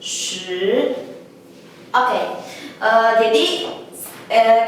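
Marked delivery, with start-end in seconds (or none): 0.0-1.0 "Shi" held as one long syllable; Mandarin tone demo. Shown as a demonstration. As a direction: model the tone of "Shi" in isolation. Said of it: "Ini adalah nada sepuluh". ("Shi" satu suku kata panjang bernada Mandarin)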